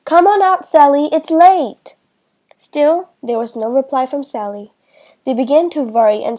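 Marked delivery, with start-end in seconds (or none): 0.0-2.0 yelling again. A narrator's reading. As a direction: call out harder, starting to worry. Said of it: "They yelled again".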